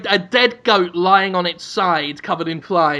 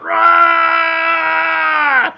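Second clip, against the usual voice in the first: high, loud roaring "Rah!"; dinosaur roar shout; 9 semitones higher, 4 dB louder than usual. Pretend. Performer playing a dinosaur for kids, loud roar. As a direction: roar it out like a T-Rex. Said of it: "and you will shout".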